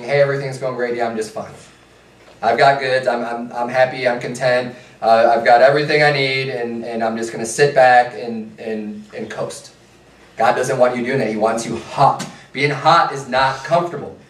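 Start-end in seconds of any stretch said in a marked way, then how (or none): 0.0-9.7 complacent contentment. Acted. Preacher mimics a lukewarm believer sitting back, complacent. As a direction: settle back and coast, low and easy.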